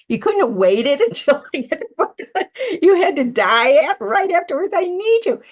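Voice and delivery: high, loud, laughing scold at a dead friend; half-joking fury at him for dying; playfully scolding her late friend for leaving her book unfinished; mock angry scolding. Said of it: "And I'm mad at Joe".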